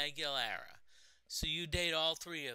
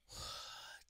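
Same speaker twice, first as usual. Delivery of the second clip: soft exasperated exhale; weary sigh; 13 dB quieter than usual. Real, not acted. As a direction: blow out a long, voiceless sigh. Exasperated at the game.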